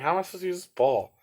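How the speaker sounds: high, fast, incredulous; how is this supposed to work; exasperated. Retold or imitated. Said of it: "I'm like".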